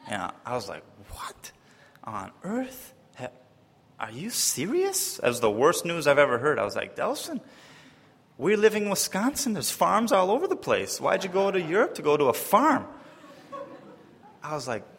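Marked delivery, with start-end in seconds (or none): 1.1-5.2 mock disbelief. Retelling his shocked reaction. 7.0-12.9 high-pitched mock disbelief throughout; incredulous. Retelling his incredulous reply to Delson.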